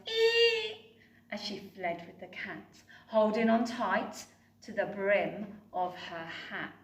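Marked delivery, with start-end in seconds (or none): 0.1-1.0 loud high shriek; high squeal. Acted. Betty fleeing the flood with the cat, high shriek. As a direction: scream as she flees. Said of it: "Betty yelled".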